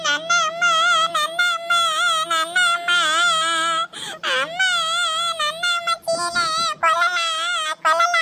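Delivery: put-on crying child. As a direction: sob and wail for mother like a toddler. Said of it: "ಅಳ್ಬೇಡ, ಸುಮ್ನಿರು". (ಅಮ್ಮನಿಗಾಗಿ ಅಳುವ ಮಗು)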